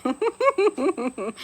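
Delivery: high-pitched, helpless laughter through the words; laughing hard. Real, not acted. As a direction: tell the fond memory while cracking up. Laughing over memory of her son.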